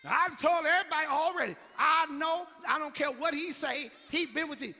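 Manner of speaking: loud, high insistent shout; a woman hollering she knows. Acted. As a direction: yell it so everyone hears. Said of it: "She said".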